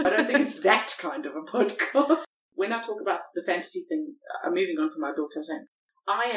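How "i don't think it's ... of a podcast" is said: amused, laughing through the words; louder, giggly deflection. Real, not acted. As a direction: laugh off the innuendo. Laughing at accidental innuendo.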